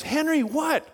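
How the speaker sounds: high, fussing voice of a tiny lady; alarmed concern, played up; worried trainer. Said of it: "And she goes".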